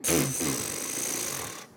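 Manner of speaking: deep buzzing lip drone; low drone buzz. Shown as a demonstration. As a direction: buzz the lips low and loose. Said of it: "is to try to get a ... sound".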